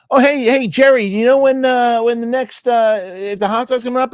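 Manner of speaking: high, loud mock supervisor voice; imitating the supervisor. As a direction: call over to Jerry, high and bright. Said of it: "she's like".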